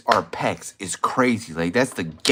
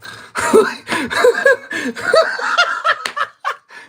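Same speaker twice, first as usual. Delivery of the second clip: very high, long mock giggle; shrill squealing laugh, drawn out in bursts; hammed-up high giggle; high-pitched laugh; 4 dB louder than usual. High sound after mocking swoon.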